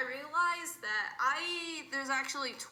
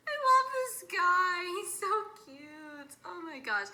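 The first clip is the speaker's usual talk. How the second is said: adoring gush; 5 semitones higher than usual. Real, not acted. Fan declaring love for favorite character, gushing. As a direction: draw the words out, bursting with affection.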